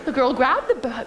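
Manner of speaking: ordinary delivery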